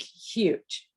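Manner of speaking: normal talking voice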